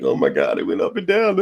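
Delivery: a hammed-up little scream of market panic; mock freak-out. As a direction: play a jittery investor losing it, half joking. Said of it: "You're not freaking out like".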